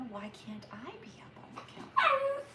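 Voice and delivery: high, whiny dog voice; high complaining puppy voice; voicing the dog. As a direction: plead as the jealous little dog. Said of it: "she's gonna be like".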